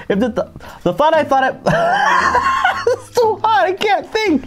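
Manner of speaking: high, breathless laughter; laughing. Real, not acted. Laughing with mouth on fire from the pepper. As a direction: laugh through the burning.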